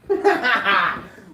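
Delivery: loud high-pitched cackle; high laugh. Acted. Laugh after intro.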